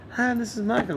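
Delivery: Michael calling early in the morning, high gentle voice; Michael's voice. Retold or imitated. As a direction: mimic his light high phone voice. Impersonating Michael on phone.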